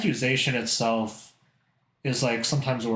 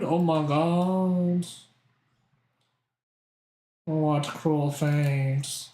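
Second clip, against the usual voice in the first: mock melodrama; 3 semitones higher, much slower than usual. Mock dismay at a food curse.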